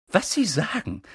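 high, urgent professor voice; pressing. A narrator's reading. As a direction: press on insistently, pitched high. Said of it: "drängte sie weiter". (hoch und drängend)

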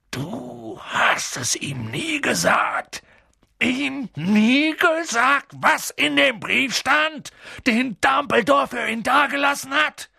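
langsam, hoch, bebend vor Zorn; zitternd vor Entrüstung. A narrator's reading. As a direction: jede Silbe zittert vor Empörung. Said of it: "zitterte jede Silbe vor Entrüstung". (Hagrid fragt Vernon empört, zitternd vor Entrüstung)

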